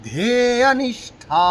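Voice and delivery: ধেয়নিষ্ঠা chanted with ষ and ঠ on the roof of the mouth; retroflex ষ্ঠ. Shown as a demonstration. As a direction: curl the tongue up for ষ্ঠ in ধেয়নিষ্ঠা. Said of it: "মূর্ধন্য ষ ঠয় কিন্তু মূর্ধা স্থানে". (ধেয়নিষ্ঠা-র ষ্ঠ মূর্ধায় জিভ লাগিয়ে)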